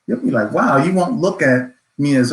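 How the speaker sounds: usual speaking voice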